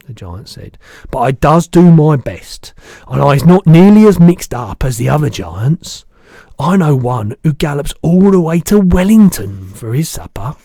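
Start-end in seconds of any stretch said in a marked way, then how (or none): 1.1-10.6 the giant's voice. Acted. Giant correcting the girl, big booming voice.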